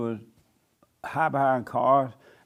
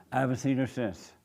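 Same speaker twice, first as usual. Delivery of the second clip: ordinary delivery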